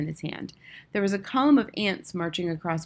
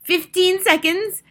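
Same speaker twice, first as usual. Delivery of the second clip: very high, loud, slow countdown; piercing drawn-out witch call; crying out; 13 semitones higher, 9 dB louder, slower than usual. A narrator's reading. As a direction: shriek the count as a gleeful witch. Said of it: "cried the Grand High Witch".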